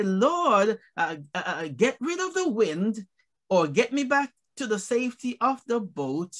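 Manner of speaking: deliberate, spaced-out phrases with pauses; slowed down. Shown as a demonstration. Preacher slowing for note-takers, deliberate and measured. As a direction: give each phrase time to be written down. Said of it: "Let me just slow down a bit".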